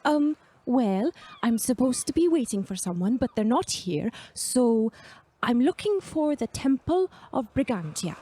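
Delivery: lightly voiced hesitant young traveler; unsure young Agnar. Novice asking a stranger for directions, mildly hesitant.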